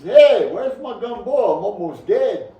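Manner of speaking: loud, high demanding yell; indignant old man. Retold or imitated. Dying grandpa demanding his gumbo, loud and indignant. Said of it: "He says".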